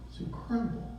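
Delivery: hushed, high, awed whisper; amazed whisper. Retold or imitated. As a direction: breathe it out in wonder. Man amazed by dog.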